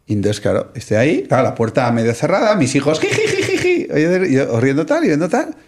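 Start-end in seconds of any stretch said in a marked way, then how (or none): 3.1-3.9 high tittering ji ji ji; imitated kids giggling. Father imitating his kids giggling at him, high mock giggle. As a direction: mimic the children's giggle, way up high.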